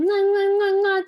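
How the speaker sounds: shower her with loud kisses; kiss noises. Retold or imitated. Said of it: "I'm going from".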